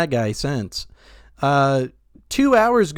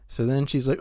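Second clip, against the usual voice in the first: usual speaking voice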